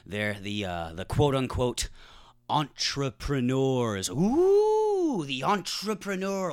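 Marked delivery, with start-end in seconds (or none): 4.1-6.5 mock awe. Real, not acted. Mocking fancy word.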